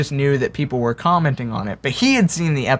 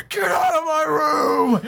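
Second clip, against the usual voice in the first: high, slowed mock scream of an angry roommate; hammed-up furious roommate, pitched high; retold yell; 12 semitones higher, slower than usual. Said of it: "starts screaming".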